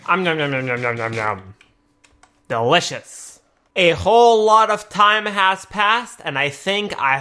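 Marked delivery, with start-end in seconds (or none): 0.1-3.4 munching noises. Acted. Voicing villagers eating.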